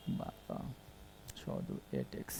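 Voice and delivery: barely audible, near-whispered working-out; very soft, voiceless mental arithmetic aloud; muttering to oneself. Real, not acted. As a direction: murmur the sum under your breath. Working out the sum to himself.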